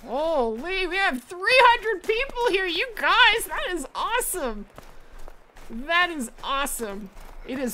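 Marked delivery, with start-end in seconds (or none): 0.0-4.7 excited. Real, not acted. Thrilled at 300 viewers.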